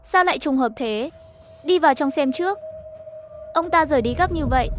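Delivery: usual speaking voice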